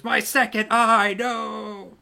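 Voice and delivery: high, hammed-up wail of a one-eyed orc; mock despair, voice up high; eye-patched orc losing his other eye; orc's anguished cry. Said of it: "the one with the eye patch goes".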